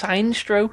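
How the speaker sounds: Sinestro said as SINE-stro; mispronounced Sinestro. Shown as a demonstration. Co-host quoting his friend's wrong pronunciation, Sinestro as SIGN-stroh. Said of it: "you think it's pronounced".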